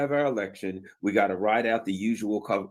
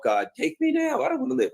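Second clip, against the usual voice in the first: hammed-up wail, pitched way up high; mock anguish. Gym buddy groaning through the workout, in high mock despair. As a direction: cry out to God like a dying man, jokingly. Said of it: "We gripe, we grumble".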